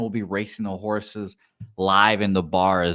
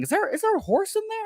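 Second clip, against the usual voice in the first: much higher, quick mock-baffled question; imagined maintenance man; much faster than usual. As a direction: voice a startled onlooker in falsetto.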